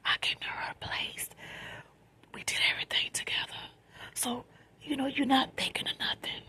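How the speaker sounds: soft late-night whisper; quiet at night. Real, not acted. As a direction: whisper so nobody wakes up. Late-night storytime, hushed.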